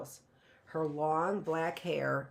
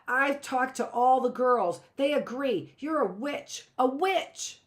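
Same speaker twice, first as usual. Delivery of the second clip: higher, louder taunt; 7 semitones higher, 5 dB louder than usual. A narrator's reading. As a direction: rub it in. Judith keeps taunting.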